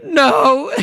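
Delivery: high mock wail; Homer. Re-enacting dad's tearful reply to son, high mock wail. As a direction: imitate a dad wailing his answer. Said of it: "the way that Homer plays that".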